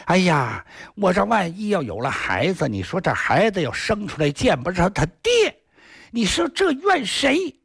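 尖高声调，絮絮叨叨; 略带疯癫地自问自叹; 疯癫妇人. A narrator's reading. 疯疯癫癫的媳妇自言自语. (疯疯癫癫的媳妇摸着肚子念叨)

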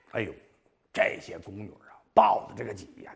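plain reading voice

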